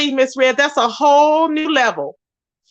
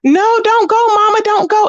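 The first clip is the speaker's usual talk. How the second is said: put-on high, pleading child's voice, lightly done; a hint of a sobbing toddler; imitating crying child; 9 semitones higher than usual. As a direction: mimic a little boy begging his mom to stay. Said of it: "She had her baby on camera crying".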